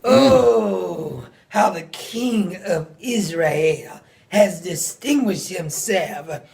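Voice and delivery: ordinary delivery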